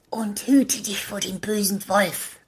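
hushed grandmother's whisper; whispered warning. Acted. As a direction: warn the child in a whisper. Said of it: "warnte die Großmutter".

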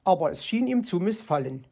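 ordinary delivery, nothing marked

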